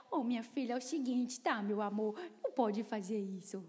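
put-on soft, low sing-song to a small child; baby talk to a toddler. Mother softly cooing to her small daughter. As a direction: coo it like talking to a two-year-old. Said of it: "Eu não falo mais com elas como se elas tivessem dois anos de idade".

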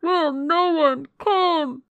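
high, loud, slow wail for rescue; desperate cry. A narrator's reading. Voice from the room above during a struggle, desperate cry. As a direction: call out slowly, pleading at full voice. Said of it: "it cried".

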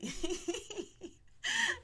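high peal of laughter; big laugh. Real, not acted. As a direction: crack up about daddy. Laughing about her father.

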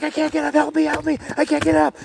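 very high, strained cry for help; imitated screaming. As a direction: mimic a pinned-down friend pleading in panic. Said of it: "He was screaming".

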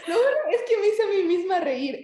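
loud, high, laughing through words. Real, not acted. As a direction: laugh at your own joke. Laughing at own joke.

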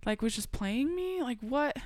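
mild mock fury at the birth mom; livid. Livid daughter questioning her birth mom's story. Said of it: "So then I'm livid at her".

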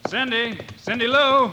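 calling out. Acted. Bill searching the trees for Cindy, calling out. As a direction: call out to find her.

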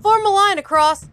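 high called order; crying out. A narrator's reading. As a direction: call the command to the others. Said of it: "he cried".

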